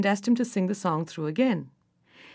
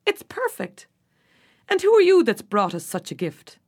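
fairy king; 4 semitones higher, 5 dB louder than usual. A narrator's reading. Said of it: "said the King of the Fairies".

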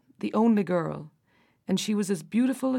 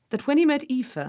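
ordinary delivery, nothing marked

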